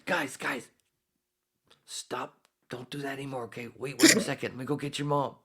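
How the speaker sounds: high, pleading talk to toddlers; high, soothing uncle voice to messy twins; gentle scolding uncle. Retold or imitated. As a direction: stop the kids kindly but urgently. Uncle scolds toddlers covered in mess.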